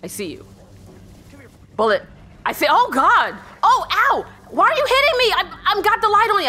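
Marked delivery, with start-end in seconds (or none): 2.7-6.5 high-pitched startled protest under attack; panicked yelping. Real, not acted. Player to Bullet while being hit, panicked yelp. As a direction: yelp in alarm as the hits land.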